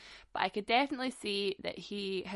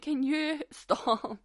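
exasperated plea; 6 semitones higher, 3 dB louder, much slower than usual. Retold or imitated. Telling friend to stop filming.

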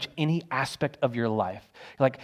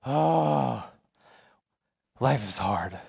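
mock weary sigh; 3 semitones lower, much slower than usual. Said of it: "look at your own life and go".

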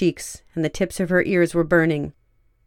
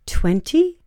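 breathy, much higher surprised question; breathy surprise; 10 semitones higher than usual. A narrator's reading. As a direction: gasp the number out in disbelief. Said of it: "said in a breathy voice".

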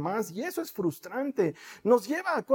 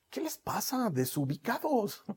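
amused scolding; 7 dB quieter, slower than usual. Retold or imitated. Jesús regaña a discípulos.

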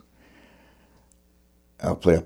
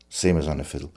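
usual speaking voice

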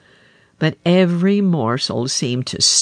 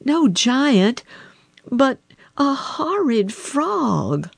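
higher, slow disgusted princess; 10 semitones higher, slower than usual. A narrator's reading. Princess telling the king about the frog, disgusted. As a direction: drag out horrid in distaste. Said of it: "answered she".

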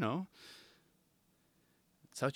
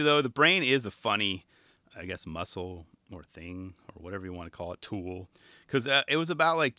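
normal talking voice

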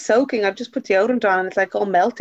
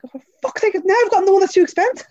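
high-pitched mock dismay; retold exasperation; 12 semitones higher, faster than usual. Telling of picking too dear a policy, exasperated. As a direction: wail it in comic exasperation. Retelling dismay at costly insurance.

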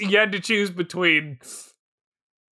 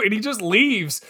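usual speaking voice

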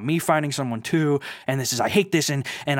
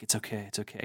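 hushed, much softer comforting murmur; soothing; 4 semitones lower, 13 dB quieter, faster than usual. Retold or imitated. Dale hugging Claire after the yelling, soft hushed comfort. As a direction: mimic a guy quietly calming a crying woman. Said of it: "he's like".

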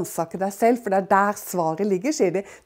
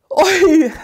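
oi exclamation; 7 semitones higher, 8 dB louder than usual. Real, not acted. Reacts to Job's words.

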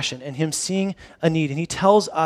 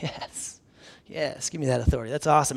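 hushed eager whisper; 4 semitones lower, 9 dB quieter than usual. Retold or imitated. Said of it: "I'm like".

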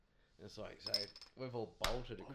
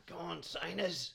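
higher, drawn-out crowd call; 9 semitones higher, 4 dB louder, slower than usual. Retold or imitated. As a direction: call it out to the bowler from the stands. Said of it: "he's going".

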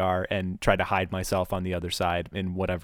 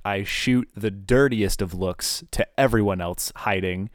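normal talking voice

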